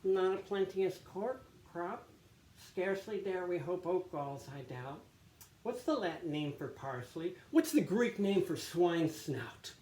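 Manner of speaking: high-pitched mocking imitation of a pompous monk; acted-out soliloquy. Monk mimicking Brother Lawrence at table, high sneering mockery. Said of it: "try to act this out".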